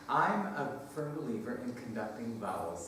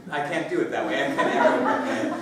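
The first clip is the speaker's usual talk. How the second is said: laughing; 9 dB louder, faster than usual. Real, not acted. Laughs at own attempt.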